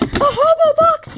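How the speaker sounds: very high, slow and loud squeal; excited squeal. Real, not acted. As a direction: draw it out in a gleeful squeak. Delighted about the hobo box.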